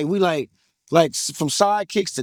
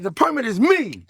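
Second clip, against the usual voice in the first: cocky boast; 7 semitones higher than usual. Retold or imitated. Repeated boast, first take.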